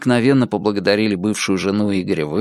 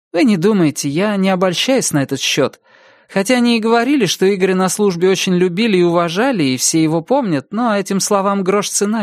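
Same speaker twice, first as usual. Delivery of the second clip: somewhat higher woman's voice, candid; lightly voiced frank ex-wife; 8 semitones higher than usual. Ex-wife frankly sizing up Igor to police, lightly higher voice. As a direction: lightly put on a higher voice, owning up.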